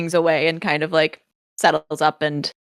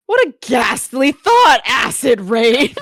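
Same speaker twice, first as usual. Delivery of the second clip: louder, much higher, slow, horrified aristocrat; appalled gentleman; 6 semitones higher, 6 dB louder, slower than usual. Acted. Josh recoiling from the idea of acid rain, loud and appalled. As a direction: recoil at the very idea, slowly and loudly. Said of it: "He looks and goes".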